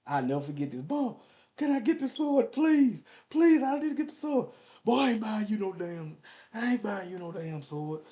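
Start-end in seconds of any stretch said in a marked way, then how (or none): 0.9-4.5 put-on high-pitched begging kid voice; pleading child. Boy begs his mama for the sword, high pleading child voice. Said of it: "I said". 4.8-8.0 lightly voiced scolding parent, higher pitched; refusing parent. Mama turns down her son's plea for a sword, mock scolding parent. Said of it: "you know how your parents is".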